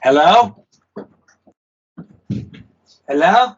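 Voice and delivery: loud, high call of hello. Real, not acted. Musician calling out to his arriving bandmate. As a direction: call down to whoever just came in.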